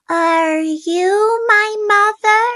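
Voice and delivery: high little bird question; a hopeful chick asking; baby bird voice. A narrator's reading. Baby bird asks the hen, high and hopeful. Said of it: "he said to the hen".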